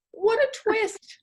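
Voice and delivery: normal talking voice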